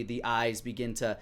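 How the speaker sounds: usual speaking voice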